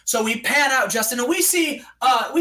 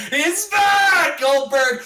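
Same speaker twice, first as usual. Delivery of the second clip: shouted high and loud, hyped; excited shout; 6 semitones higher, 4 dB louder, much slower than usual. Pretend. Fan announcing Goldberg's return, hyped shout. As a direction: yell it like the crowd just erupted.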